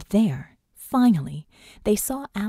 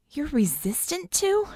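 high, slow, rising disbelief; incredulous drawn-out question; astonished question; 8 semitones higher than usual. A narrator's reading. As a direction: echo it back, stunned, ending upward. Astonished echo of his claim.

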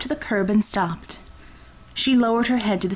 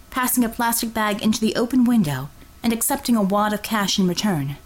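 ordinary talk

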